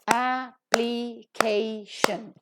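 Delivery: application very slowly, broken into a-pli-kay-shun; syllable by syllable. Shown as a demonstration. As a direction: separate all four syllables of application. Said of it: "Four syllables are there".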